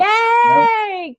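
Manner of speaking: a high, loud burst of real joy; delighted cheer. Real, not acted. Cheering her host's offer to bring her HSP people, delighted squeal. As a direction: squeal it out, thrilled.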